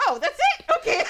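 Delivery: high-pitched, relieved surprise; acted-out relief. Kid hearing from dad that forgiveness is easy, relieved. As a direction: replay a child's happy relief at an easy answer. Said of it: "the biggest relief".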